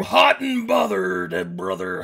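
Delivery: loud, shouted wrestler trash talk; pro wrestler promo. Pretend. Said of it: "I'm the lewd wrestler".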